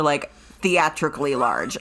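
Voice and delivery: ordinary delivery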